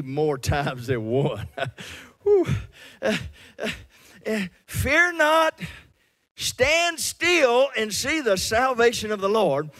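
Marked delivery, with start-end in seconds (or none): 4.7-9.7 high, loud preacher's call; full-force preaching. Acted. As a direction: thunder it out to the congregation.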